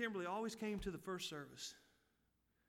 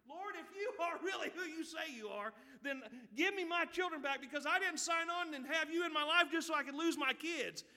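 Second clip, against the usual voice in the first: high, loud imitated pleading prayer; earnest prayer; 9 semitones higher, 6 dB louder than usual. Preacher voicing a mother praying for her children back, earnest pleading. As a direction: plead with God as she would, pitch raised high. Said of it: "she was earnestly praying".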